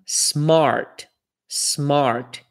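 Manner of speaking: smart with an American R before the T; English R demo. Shown as a demonstration. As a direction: say smart, curling the R. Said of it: "Próxima".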